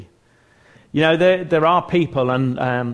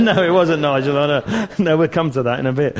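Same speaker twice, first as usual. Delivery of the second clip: real chuckle breaking into the words; amused reply through laughter; laughing; 3 semitones higher, 3 dB louder than usual. Real, not acted. Speaker answering a heckler in the meeting, laughing.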